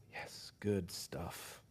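breathless whispered aside. Real, not acted. Preacher reacting privately to his own point, whispered. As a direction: whisper it to yourself, very slowly. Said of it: "whispered aside".

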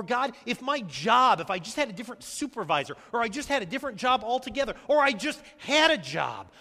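half-earnest cry of complaint to God. Acted. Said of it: "we've kinda cried out to God".

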